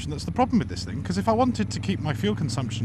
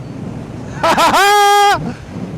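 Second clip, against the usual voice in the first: high loud laugh; 7 dB louder than usual. Real, not acted. Laughing after his silly cry.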